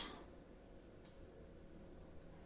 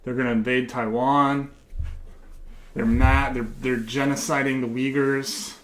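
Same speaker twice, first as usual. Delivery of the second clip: mock patriotic pandering. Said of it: "I'm saying all the things you want me to say".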